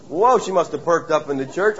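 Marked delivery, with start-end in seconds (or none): 0.1-0.4 startled whoa. Pretend. Euodia startled.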